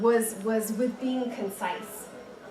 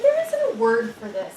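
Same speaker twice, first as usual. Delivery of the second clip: insistent; 8 dB louder than usual. Retold or imitated. Arguing with her grandmother.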